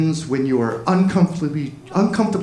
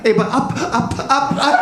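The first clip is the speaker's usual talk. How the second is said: mock child's plea, higher pitched; begging to be lifted; 8 semitones higher than usual. Man runs to his taller girlfriend, begging like a toddler. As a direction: pitch it up, pleading like a little one.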